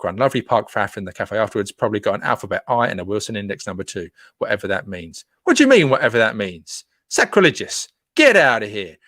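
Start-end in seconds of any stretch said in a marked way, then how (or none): 5.4-9.0 playful indignation, louder, pitch up on sacrilegious; hammed-up offence at a fan; mock outrage.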